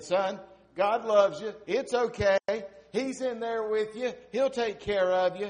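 put-on high, loud reassurance to a child; a tired dad calling back to soothe his son; tired father. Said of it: "Daddy was tired".

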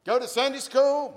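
high, loud question; mock invitation. Pastor playing the asker in a mock dialogue, loud. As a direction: call out the invitation brightly.

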